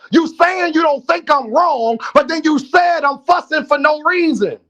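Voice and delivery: high-pitched, forceful arguing; yelling. Real, not acted. Host disputing Juke's claim of fussing for no reason, yelling. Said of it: "when I'm yelling".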